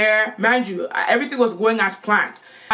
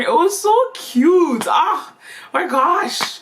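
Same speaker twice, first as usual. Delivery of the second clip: gushing; 8 semitones higher, much slower than usual. Real, not acted. Gushing over first love.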